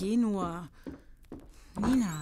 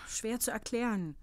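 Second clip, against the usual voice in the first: ordinary talk, nothing marked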